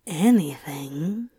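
breathy low whisper. Acted. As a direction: whisper it slowly, teasing. Seductive whispered question.

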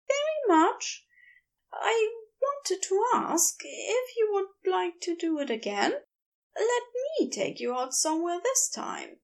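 high, tender request; hopeful Aziraphale. Acted. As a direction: put himself out there.

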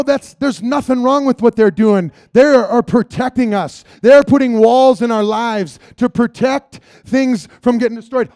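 normal talking voice

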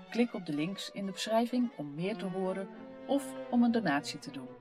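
ordinary talk

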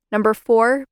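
usual speaking voice

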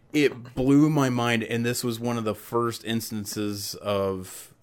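normal talking voice

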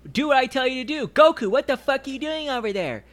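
high, loud tirade of abuse; imitated yelling. Friend mimicking Bulma bossing Krillin and Goku around, yelling. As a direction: mimic a bossy character screaming orders. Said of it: "She's yelling at everybody".

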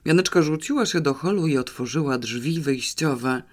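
usual speaking voice

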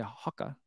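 "Hoka" as HAH-kuh; British-style Hoka. Shown as a demonstration. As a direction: say "Hoka" like "hocka". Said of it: "when they say Hoka".

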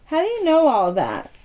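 put-on a boy amazed at the girl's tale of the moor. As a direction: catch her out, amazed. Colin amazed by Mary's tale.

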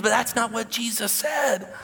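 high, slow preacher's shout; forceful declaration. Retold or imitated. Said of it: "He says".

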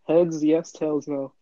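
ordinary delivery

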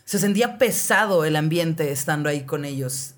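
ordinary delivery

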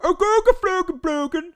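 high, loud mock Swedish Chef babble; hammed-up Swedish Chef gibberish. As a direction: squeal nonsense like the Muppet chef. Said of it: "I'm just doing Swedish Chef".

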